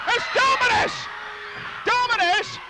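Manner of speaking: excited shout. Real, not acted. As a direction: shout the name in disbelief. Surprise wrestler appears.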